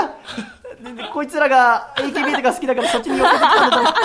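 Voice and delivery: high, loud laughing excuses; real embarrassed laughing; flustered laughing. Real, not acted. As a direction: babble through embarrassed laughter. Host flustered after wrong name.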